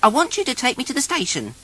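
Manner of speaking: high, bossy monkey voice; ordering the taxi about; Martha Monkey's voice. Acted. Martha Monkey tells Noddy where to go, high and bossy.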